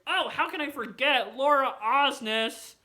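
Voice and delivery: loud, high, drawn-out gush; excited sudden recall. Real, not acted. Vlogger remembering another concert highlight, loudly thrilled. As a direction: exclaim it slowly, thrilled to remember.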